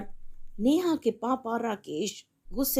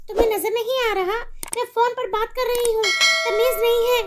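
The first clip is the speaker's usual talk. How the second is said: much higher, louder, fast scolding; furious mother; 13 semitones higher, 5 dB louder than usual. Acted. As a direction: chase the daughter away. Mother yells.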